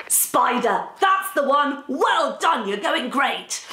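high-pitched, bouncy congratulations; excited praise. Pretend. Host congratulates listeners on the spy clue, excited. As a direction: celebrate the right answer eagerly. Host cheers second right answer.

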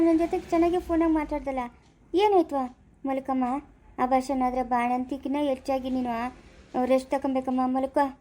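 ordinary delivery

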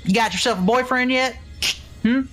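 a hint of a Texan relative at Thanksgiving; nosy Texan relative. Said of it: "sounds like my Texan relatives I've met at Thanksgiving".

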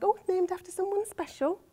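high, soft imitation of polite interest; smiling enquirer. Mother quotes people asking about her son's name, sweet imitation. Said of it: "most people smile and ask".

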